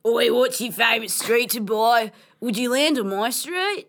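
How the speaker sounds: loud, broad Aussie read; Aussie accent. Acted. Reading a dating chat about board games, Aussie accent. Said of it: "I reckon we should do this in Aussie".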